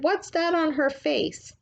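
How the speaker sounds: put-on high, slow, strange old lady; old woman's voice. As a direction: wonder aloud like a curious blind old woman. Missus Partridge voiced.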